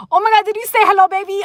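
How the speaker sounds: high, loud burst of excitement; thrilled, loud and high; excited squeal. Real, not acted. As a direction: shriek it out with delight. Excited reaction to viewer comment.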